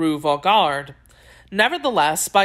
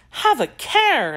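slow, high-pitched warning; earnest caution, drawn out; serious exclamation; 11 semitones higher, slower than usual. A narrator's reading. Young woman warns her suitor, serious exclamation. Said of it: "exclaimed the young woman in a tone of seriousness".

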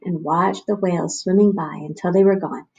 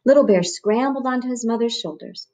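ordinary delivery

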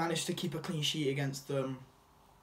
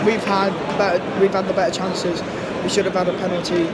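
loud, urgent match commentary; live play-by-play; 5 semitones higher, 11 dB louder than usual. Real, not acted. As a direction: call the action as it happens.

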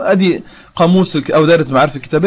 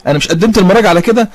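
usual speaking voice

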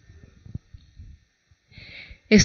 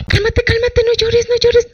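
much higher, imitating fussy people hushing a crier; mock consoling; 13 semitones higher, 3 dB louder than usual. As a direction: parrot the anxious crowd saying calm down, pitched way up. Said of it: "toda la gente sí ve que empieza a llorar". (imitando a la gente que consuela, con voz mucho más aguda)

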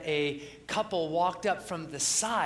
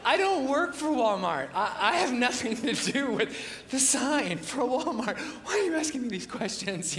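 laughing bell ringer; 5 semitones higher than usual. Retold or imitated. Bell ringer answers baffled.

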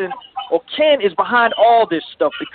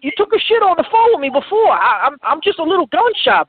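higher, pleading with a friend not to yell; upset pleading; 6 semitones higher than usual. Acted. Caller begging Tony not to yell at him, pleading. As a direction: whine that you've been burned before.

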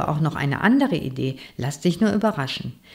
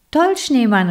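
higher, louder happy call; excited call; 7 semitones higher, 5 dB louder than usual. A narrator's reading. Bookworm calls out to the snowman, delighted and louder. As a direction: cheer the snowman on. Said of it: "rief ich".

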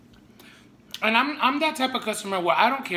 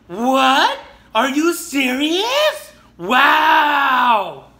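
loud, high mocking disbelief; shaming disbelief; 7 semitones higher, 8 dB louder, much slower than usual. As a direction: scoff loudly like a shocked coworker. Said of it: "Like, shaming me".

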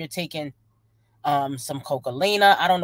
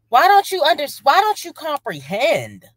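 high, loud, incredulous plea; exasperated outburst; 10 semitones higher, 6 dB louder than usual. Real, not acted. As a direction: cry out in exasperation, dragging the last words. Exasperated reaction to the vlogger.

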